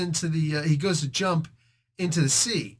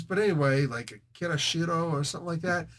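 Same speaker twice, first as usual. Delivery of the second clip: normal talking voice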